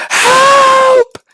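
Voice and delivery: one long, very high loud scream; screaming for help. Acted. Beaten inmate calling the guards, screaming for help. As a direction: stretch the cry out at full force. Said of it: "This guy's screaming every night".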